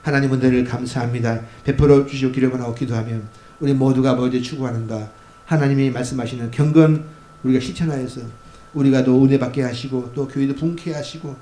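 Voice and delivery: loud, fervent pulpit prayer; closing prayer. Acted. Pastor leading the congregation in closing prayer, loud and fervent. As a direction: pray boldly over the congregation.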